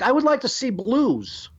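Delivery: a tourist asking politely to see blues. Retold or imitated. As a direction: put on a stiff polite tourist.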